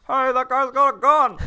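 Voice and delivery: high, loud strained warning shout; mock yell. Brogan warning people before the shootout, yelling. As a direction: yell it through a window. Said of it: "looks through a window and yells".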